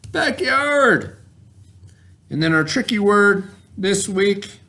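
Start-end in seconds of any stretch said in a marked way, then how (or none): 0.1-1.2 "Backyard." sung out high and excited; much higher "Backyard.". Shown as a demonstration. Teacher celebrates the blended word for kids, much higher. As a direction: reveal the word with a big lift. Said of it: "And the word is B, A, K, Y, R, D".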